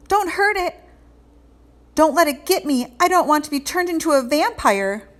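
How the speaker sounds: panicky. Acted. Arnold panics at the bat.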